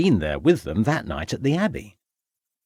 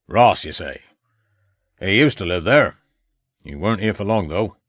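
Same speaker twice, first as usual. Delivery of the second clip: put-on old farmer. A narrator's reading. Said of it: "The man stared at Ravenscroft".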